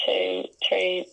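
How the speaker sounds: normal talking voice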